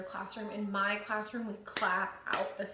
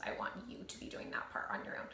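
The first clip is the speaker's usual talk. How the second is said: fast whispered aside to the class; 7 semitones lower, 7 dB quieter, much faster than usual. Real, not acted. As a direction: whisper it quickly so only they hear. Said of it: "whispered instruction".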